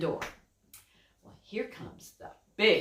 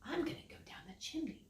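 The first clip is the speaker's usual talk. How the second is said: fast sneaky whispered plot; 5 semitones higher, 15 dB quieter, faster than usual. Acted. Wolf plotting to sneak down the chimney, whispered. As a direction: whisper the wolf's scheme quickly. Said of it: "the big bad wolf says".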